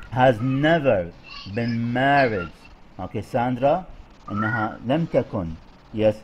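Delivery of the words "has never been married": slow, with "never" and "married" each leaned on; stress on never, married. Shown as a demonstration. Teacher modelling sentence stress on "never" and "married". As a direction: spell out the beats on "never" and "married". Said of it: "أنا شو ركزنا".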